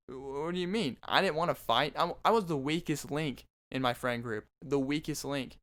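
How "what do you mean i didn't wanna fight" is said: normal talking voice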